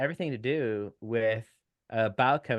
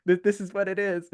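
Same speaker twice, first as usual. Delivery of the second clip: casual mock quote; 9 semitones higher, 5 dB louder than usual. Mock self quoting online post.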